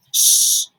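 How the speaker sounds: whispered hiss to hush; hushing shh. Acted. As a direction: shush her fast. Kate hushing.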